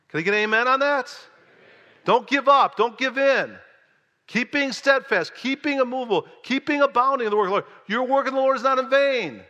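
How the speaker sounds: high, loud, building sermon cry; full-force preaching. Acted. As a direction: preach it like Paul, rising to a climax. Exhorts congregation at full force.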